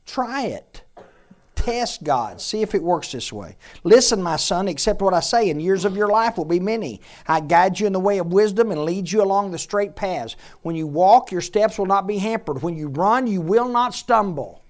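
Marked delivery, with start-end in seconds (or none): 3.8-14.6 raised, loud preacher's reading; forceful scripture reading. Acted. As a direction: proclaim each line with punched key words. Reads scripture aloud with force.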